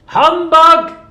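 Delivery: very loud, high bark; Scrooge shouting. Acted. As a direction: hurl the word at him. Scrooge final retort.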